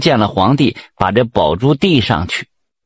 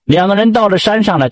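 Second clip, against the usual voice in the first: usual speaking voice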